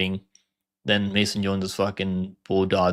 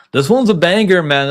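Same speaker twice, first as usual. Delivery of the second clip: higher and louder, hyped exclamation; excited; 7 semitones higher, 7 dB louder than usual. Real, not acted. Fan hyping a fight matchup to viewers, excited. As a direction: burst out with real excitement about the fight.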